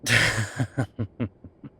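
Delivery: loud burst of laughter; short amused laugh. Acted. Professor tickled by the assistant's teasing, loud laugh.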